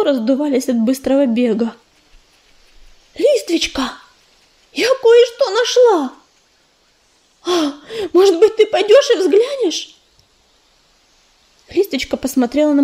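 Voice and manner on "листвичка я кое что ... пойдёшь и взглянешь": excited. Acted. Breathless messenger with news.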